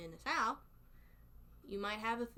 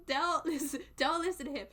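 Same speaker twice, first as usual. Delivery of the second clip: loud, raised, repeated plea; amused protest; 5 semitones higher, 7 dB louder than usual. Real, not acted. Streamer warning viewers off a troublemaker, loud amused protest. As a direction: repeat it loudly, higher, on the edge of laughing.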